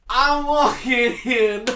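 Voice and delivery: high, loud, drawn-out bad celebrity impression; drunk Christopher Walken. Streamer clowning with a catchphrase, mock drunk impression. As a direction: holler it slowly in a hammed-up drunk accent. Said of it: "a drunk Christopher Walken".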